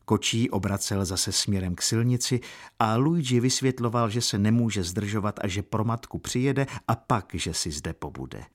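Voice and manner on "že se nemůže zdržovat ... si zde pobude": plain reading voice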